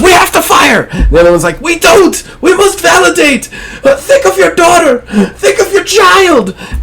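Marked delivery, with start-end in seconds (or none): 0.0-0.9 high-pitched acted scream; screaming argument. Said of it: "screaming at each other at this point". 1.6-6.6 high, desperate acted scream; hammed-up standoff yelling, strained and high; screaming argument. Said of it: "screaming at each other at this point".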